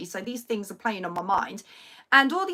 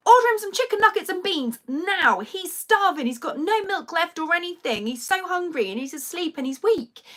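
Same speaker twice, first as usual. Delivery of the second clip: higher, louder frantic instructions; urgent orders; 7 semitones higher, 3 dB louder than usual. Retold or imitated. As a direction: insist like he is starving. Urgent orders to husband on phone.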